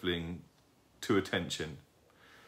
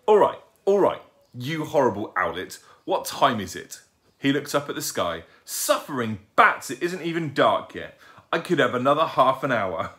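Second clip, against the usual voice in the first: louder, higher grumbling; exasperated father; 5 semitones higher, 6 dB louder than usual. A narrator's reading. Father owl woken too early by owlet, exasperated. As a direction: protest loudly at being woken.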